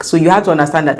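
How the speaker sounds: ordinary delivery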